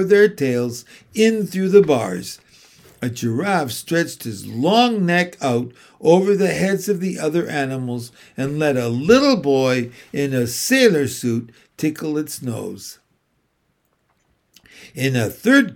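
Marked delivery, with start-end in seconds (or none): none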